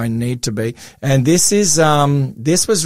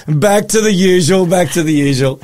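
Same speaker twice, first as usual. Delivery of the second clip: laughing; 6 semitones higher, 5 dB louder than usual. Retold or imitated. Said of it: "she's like".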